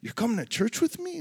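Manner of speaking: he can't believe she's coming, surprised; amazed question. Retold or imitated. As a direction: ask it stunned and thrilled. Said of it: "I was like".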